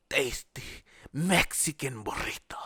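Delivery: put-on Mexican accent. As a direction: breathe it out in a playful Mexican accent. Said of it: "I don't know why I did the accent there".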